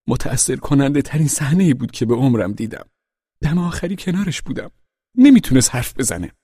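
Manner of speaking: high-pitched put-on mournful voice; tearful pig. A narrator's reading. Pig telling animals of Boxer's death, tearful high voice.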